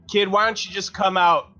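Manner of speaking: normal talking voice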